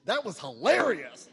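loud, slow, high amused exclaim; laughing exclaim. Retold or imitated. Esther telling the speaker how his share came off, with a loud slow amused exclaim. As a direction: boom it out slowly, tickled. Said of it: "She goes".

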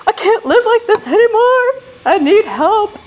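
high, loud re-enacted wail; desperate outburst. Newcomer at her first meeting, desperate wailed plea. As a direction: blurt it out as an acted cry for help.

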